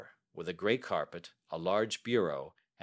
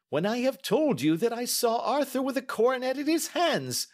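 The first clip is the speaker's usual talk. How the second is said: very high, loud exasperation; impatient cry; 6 dB louder than usual. Acted. Banker insisting he saw Arthur with the coronet, impatient. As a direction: protest loudly at the top of the voice. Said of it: "cried the banker impatiently".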